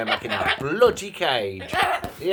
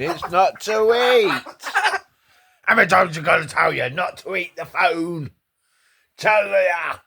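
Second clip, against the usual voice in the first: high, louder telling-off; exasperated scolding; 7 semitones higher, 4 dB louder than usual. Real, not acted. Owner scolds the parrot for chewing her phone. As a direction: tell off the phone-chewing bird.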